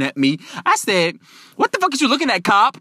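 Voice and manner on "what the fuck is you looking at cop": high-pitched, confrontational outburst; disrespectful challenge. Retold or imitated. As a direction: challenge the cop, louder on the last word. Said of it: "dis-re-fucking-spectful".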